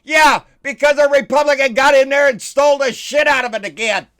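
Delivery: ranting shout; angry shout. Real, not acted. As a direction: shout it down, furious. Shouting back at Republican gripes.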